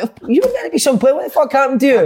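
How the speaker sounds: high-pitched pretend stranger; nosy stranger. Stranger meeting an ex-player in a cafe, mock incredulous. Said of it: "say I'm just met you in a cafe".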